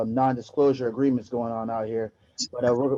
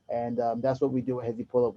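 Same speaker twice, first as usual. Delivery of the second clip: ordinary delivery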